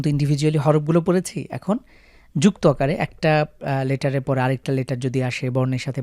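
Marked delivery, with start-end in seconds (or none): none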